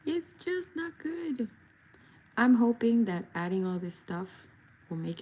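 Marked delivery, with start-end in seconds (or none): 0.0-1.5 high, slow, soft sing-song repeat. Pretend. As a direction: echo it in a higher, slower voice.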